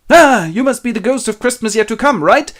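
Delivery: high, loud nervous exclamation; yelp, then babble high and jumpy; startled. Acted. Scrooge meets the haunting phantom, startled.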